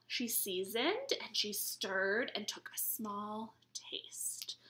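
slow, hushed storytelling whisper; whispered story line; whispered narration. A narrator's reading. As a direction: whisper it as she tastes. Reading the story softly.

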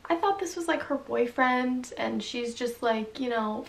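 ordinary talk

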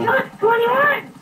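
high, drawn-out joke answer; meme voice. Pretend. [name] answers the nine plus ten joke in a high meme voice.

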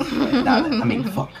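high, loud laughing through the words; real laughter. Real, not acted. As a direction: break up laughing at yourself. Laughing mid-attempt.

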